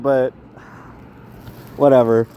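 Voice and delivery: normal talking voice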